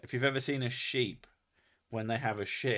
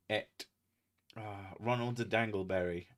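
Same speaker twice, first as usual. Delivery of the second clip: ordinary talk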